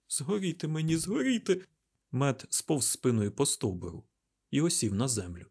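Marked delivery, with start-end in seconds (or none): 0.1-1.6 high wail of mild despair; dismayed oath. A narrator's reading. Mat sinking down against the trunk, dismayed. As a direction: repeat it with the pitch climbing.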